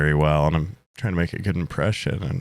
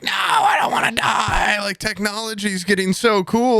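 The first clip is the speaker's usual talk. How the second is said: high, hammed-up wail; mock crying; 13 semitones higher than usual. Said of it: "me crying and being like".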